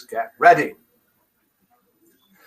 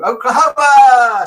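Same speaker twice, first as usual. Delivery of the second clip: loud, high, drawn-out show-title cry; high musical holler; exuberant shout; 9 semitones higher, 10 dB louder, slower than usual. Acted. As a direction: belt it out big and long. Calls out show title in full cry.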